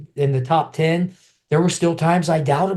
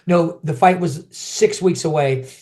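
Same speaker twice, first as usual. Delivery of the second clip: ordinary talk, nothing marked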